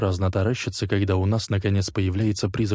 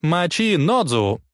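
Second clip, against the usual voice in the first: loud, high, drawn-out war cry; rally the band to attack; battle cry; 9 semitones higher, 4 dB louder, slower than usual. A narrator's reading.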